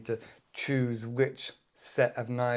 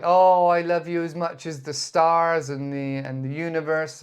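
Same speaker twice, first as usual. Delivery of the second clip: gushing declaration of love, louder at first; hammed-up lover's exaggeration; hyperbole; 4 semitones higher, 8 dB louder than usual. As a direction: swoon with an overblown "oh". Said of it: "So we do use that a lot".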